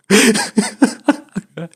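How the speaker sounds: squealing embarrassed laugh; a real high-pitched laugh; high cringe laugh. Real, not acted. Awkward story, cringing laugh.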